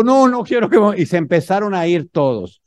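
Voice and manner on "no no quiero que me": loud, higher annoyed no; irritated shout of no; annoyed refusal. Retold or imitated. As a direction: cut him off sharply, exasperated. Man refusing, cut off.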